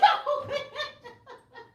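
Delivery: high laugh, fading down; laughing fit. Real, not acted. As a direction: laugh it out helplessly. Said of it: "Y'all got me cracking up here".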